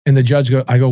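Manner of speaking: ordinary talk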